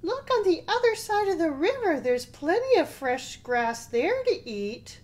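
high, loud, eager little goat; littlest billy goat. A narrator's reading. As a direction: pipe up high as the smallest goat. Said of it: "said the littlest billy goat".

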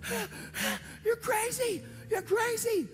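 very high, gasping, crying shriek; imitated hysterical screaming. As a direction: act out a woman screaming in hysterics. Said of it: "like, rage and screaming".

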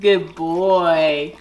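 ordinary talk, nothing marked

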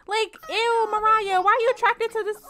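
high and loud disgusted scolding. Real, not acted. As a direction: scold her in disbelief.

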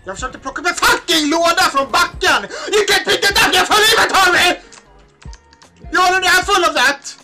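loud laughing outburst; laughing shouting. Real, not acted. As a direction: yell while laughing.

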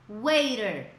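wei-der, the t flapped like an R. Shown as a demonstration. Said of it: "Um som de R também". ("waiter" com o t soando como R)

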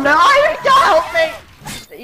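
loud, very high cry for help; panicked cry. Real, not acted. Player suddenly attacked mid-sentence, panicked yell for help. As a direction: break off into a panicked shriek.

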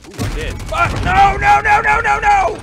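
loud high panicked no, no, no; panicked screaming. Real, not acted. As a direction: scream it as everything goes wrong. Gamer panics as he dies.

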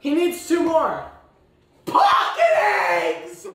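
high shout, last words drawn out; calling out. Real, not acted. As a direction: holler it, stretching the final words.

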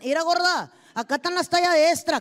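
loud husband calling out in a crowd, extra fast; husband calling his wife out in front of everyone; mock shout across the mall. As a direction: yell it across the store, careless and quick. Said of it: "todavía te gritan".

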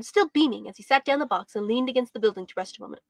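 ordinary delivery, nothing marked

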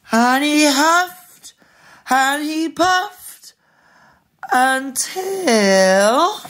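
drawn-out rising crescendo; suspenseful storytelling. A narrator's reading. Dramatic narration to children.

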